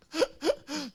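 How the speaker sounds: bright, high laugh; high burst of laughter. Real, not acted. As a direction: laugh at your own story. Laugh after the punchline.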